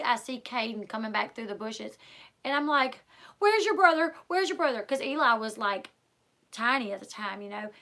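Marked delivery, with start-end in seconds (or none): 3.4-4.8 high and louder, desperate repeated question; frantic calling. Retold or imitated. Mother asks her older son where his brother is, frantic. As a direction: demand an answer, scared for the little one.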